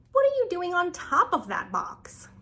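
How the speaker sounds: high-pitched, incredulous read-aloud question; questioning adult. Acted. Reads the book's questioning adult aloud.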